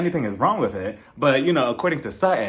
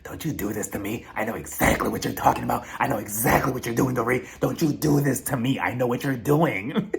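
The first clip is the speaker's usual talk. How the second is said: mock-paranoid warning, slightly lowered; imitated defensiveness; 3 semitones lower than usual. Denise warning off Dorit, mock defensive. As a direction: imitate her bristling with suspicion. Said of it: "she got defensive against Dorit".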